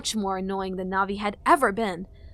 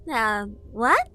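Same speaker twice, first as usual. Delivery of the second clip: fairy voice; 5 dB louder than usual. A narrator's reading.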